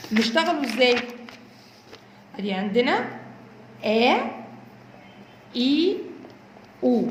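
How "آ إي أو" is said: آ، إي، أو as separate long vowels, aa, ee, oo; vowel sound drill. Shown as a demonstration. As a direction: model each long vowel alone, pausing between them. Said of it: "دي الحركات الأساسية لكل الحروف".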